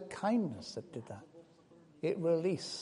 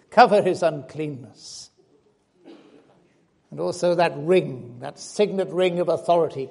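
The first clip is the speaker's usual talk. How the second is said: louder, emphatic pulpit voice; full-force preaching; 9 dB louder than usual. Acted. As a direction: drive each phrase home like a preacher. Preaching the father's gifts.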